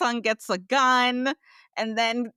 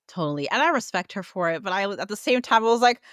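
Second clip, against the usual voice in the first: ordinary talk, nothing marked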